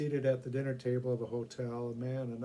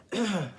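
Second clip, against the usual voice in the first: throat clearing; 6 semitones higher, 6 dB louder than usual. Acted. Coughing from pepper.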